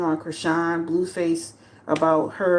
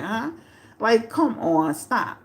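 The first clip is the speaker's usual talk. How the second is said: ordinary talk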